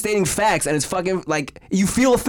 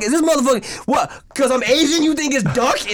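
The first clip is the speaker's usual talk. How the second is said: high-pitched indignant rant; pissed off; 7 semitones higher than usual. Retold or imitated. Fuming inwardly over friend calling chicken duck, acted irritation. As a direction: retell it worked-up and incredulous. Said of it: "I was getting fucking pissed".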